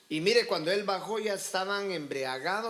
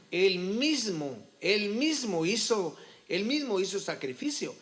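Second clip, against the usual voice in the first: slow, louder stress on "mismo"; emphatic repetition; 4 semitones higher, 4 dB louder, slower than usual. Real, not acted. Preacher on Saul making sacrifice himself, emphatic stress on "mismo". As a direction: hammer "mismo" each time, drawn out. Preacher hammering Saul's fault.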